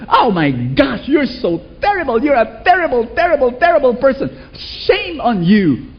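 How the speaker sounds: high, tutting scold, slowing on shame on you; mock a disgusted scolder; shaming scold. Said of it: "when you fall into temptation".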